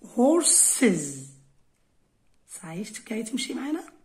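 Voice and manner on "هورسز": "هورسز" loud and slow, ending in a full iz syllable; "هورسز" punched out, extra iz syllable at the end; final IZ sound. Shown as a demonstration. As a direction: stretch "هورسز" into two syllables with a buzzing iz. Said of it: "هنايا الاس تنطق ايز".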